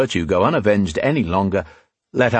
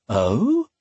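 higher, rising fake surprise; mock surprise; 4 semitones higher than usual. A narrator's reading. Man taunting the woman who admits her name, mock surprise. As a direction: feign innocent surprise. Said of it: "he asked in mock surprise".

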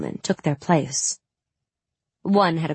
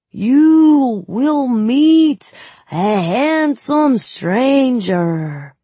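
put-on high, loud, very slow Suli accent; fortune-teller in fake Suli accent; hammed Suli accent; 8 semitones higher, 8 dB louder, much slower than usual. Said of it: "outrageous Suli accent".

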